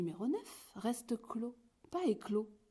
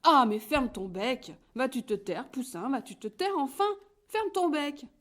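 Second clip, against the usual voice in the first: whole farmyard yelling at the chatterbox; shouting in chorus; 4 semitones higher, 9 dB louder than usual. A narrator's reading. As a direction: bellow at the chick to shut up. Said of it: "se mettent à crier".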